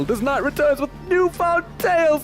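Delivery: high, hammed-up knightly put-on accent; proud knight's put-on accent, pitched high; Worm Knight accent. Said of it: "I'm sorry about the accent".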